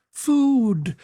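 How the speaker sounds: piercing, high starved-creature cry; shrill it out in a long falling wail. A narrator's reading. Maniac clawing at a man's throat, shrilling. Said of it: "it shrilled".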